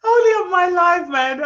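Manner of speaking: high, loud, half-laughing complaint; real laughing frustration; laughing exasperation. Real, not acted. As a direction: cry it out in amused despair.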